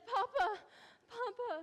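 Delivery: loud cries for Papa; calling out. Acted. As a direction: call for her father in distress. Bride calling her father.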